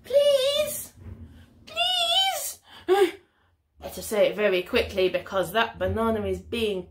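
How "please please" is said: high, squeaky begging; each please higher and more desperate; hammed-up urgent plea; pleading blue monkey. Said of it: "Blue monkey says".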